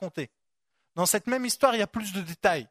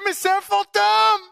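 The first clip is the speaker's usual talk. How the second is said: high, loud shriek of fright; panicked cry; 12 semitones higher, 8 dB louder than usual. Retold or imitated. Preacher replaying the frightened disciples' shout. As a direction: yell it in sheer panic.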